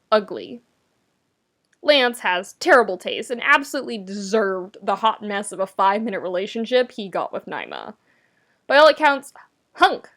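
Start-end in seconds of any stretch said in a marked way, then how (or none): none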